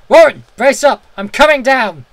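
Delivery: high, loud determined shout; resolute shout. A narrator's reading. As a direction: shout your resolve down the line. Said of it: "I formed and shouted in resolution".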